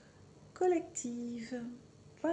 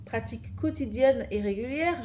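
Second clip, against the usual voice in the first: "quotidienne et régulière" stressed higher and louder; insistent emphasis; 4 semitones higher, 6 dB louder than usual. Real, not acted. Yoga teacher insisting on a daily, regular practice, insistent emphasis. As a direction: lean firmly on "quotidienne et régulière".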